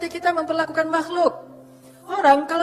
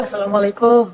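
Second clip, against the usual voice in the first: droopy, mournful salaam, a little louder; mock pitiful Assalamualaikum; pitiful greeting; 3 semitones lower, 7 dB louder than usual. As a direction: greet like a weary, hopeless woman. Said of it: "Melas gitu ya".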